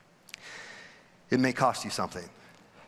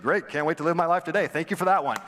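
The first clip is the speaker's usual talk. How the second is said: sarcastic; 5 semitones higher, 4 dB louder, faster than usual. Pretend.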